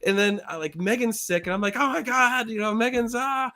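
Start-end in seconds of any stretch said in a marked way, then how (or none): 1.8-3.5 high-pitched mock distress; retold panic. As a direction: wail it in acted-out panic. Acts out panic over sick friend.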